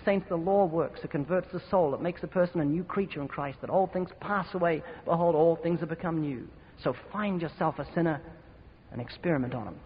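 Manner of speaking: ordinary talk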